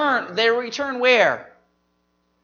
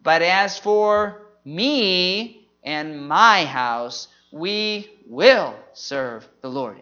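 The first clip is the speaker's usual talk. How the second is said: slow, pausing round "me", "my" and "will"; emphasis on key words; 3 semitones lower, much slower than usual. Shown as a demonstration. Showing how to memorize the verse, slow stress on "me", "my" and "will". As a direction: set "me", "my house", "we will" apart with weight. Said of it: "as you put those emphasis on".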